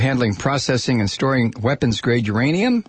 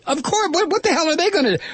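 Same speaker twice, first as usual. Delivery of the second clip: mocking; 13 semitones higher, faster than usual. Real, not acted. Mocking the security guards.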